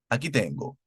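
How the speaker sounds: normal talking voice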